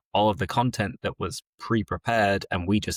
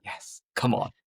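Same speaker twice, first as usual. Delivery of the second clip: unvoiced mock cheer; 3 semitones higher, 3 dB quieter than usual. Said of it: "it's like".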